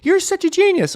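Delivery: high, mock-awed friend voice; admiring friends. Friends praising his genius, high mock awe. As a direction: gush like an impressed friend. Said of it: "everyone will be like".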